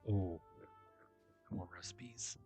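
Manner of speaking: ordinary delivery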